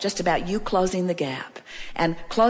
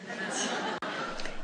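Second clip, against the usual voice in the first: quiet breathy chuckle; a soft airy laugh at her own tease; 13 dB quieter than usual. Real, not acted. Teasing the audience, a soft breathy chuckle.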